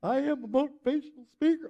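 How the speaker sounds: high, fast comic motivational speaker; hammed-up motivational speaker, quick and high; Matt Foley impression. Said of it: "reminds me of Saturday Night Live's Matt Foley".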